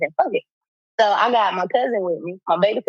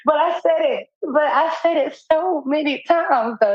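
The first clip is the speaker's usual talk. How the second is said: laughing through the words, voice pitched up high; 8 semitones higher, slower than usual. Real, not acted. Laughing as she tells her friend about the birthday visit. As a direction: crack up while admitting it.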